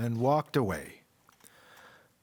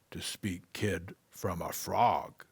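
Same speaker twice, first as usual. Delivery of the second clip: soft, unvoiced wise tree voice; tree whispering a question to the boy; 4 semitones lower, 4 dB quieter than usual. Tree replies.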